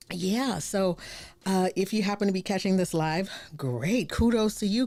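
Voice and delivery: usual speaking voice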